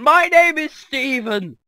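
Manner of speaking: high, very loud, drawn-out holler; hammed-up slow shouted self-introduction; mock booming introduction. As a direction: bellow your name at a stranger. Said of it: "and just like".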